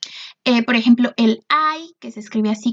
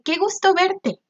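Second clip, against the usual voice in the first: bright, much higher, delighted reading of the example; glad greeting; 7 semitones higher than usual. Shown as a demonstration. Teacher reads an example to the class, delighted. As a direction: sound truly happy to see someone. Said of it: "lo dices con bastante emoción".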